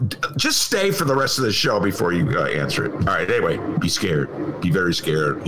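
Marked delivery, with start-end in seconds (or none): 3.8-5.5 ominous warning. Retold or imitated. Ominous slogan, low.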